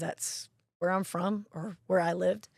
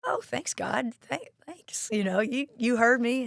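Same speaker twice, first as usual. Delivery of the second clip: high, mock-grateful sarcasm, louder at the end; sarcastic thanks; 5 semitones higher, 5 dB louder than usual. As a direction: fake gratitude with a sarcastic edge. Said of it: "And I'm like".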